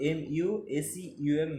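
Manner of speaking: usual speaking voice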